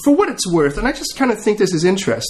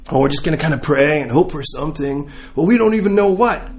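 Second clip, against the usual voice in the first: ordinary delivery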